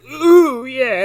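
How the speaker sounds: high, loud savoring "ooh"; mock pleasure. Host imitates the pleased bartender, mock pleasure. Acting out bartender's blissful reaction.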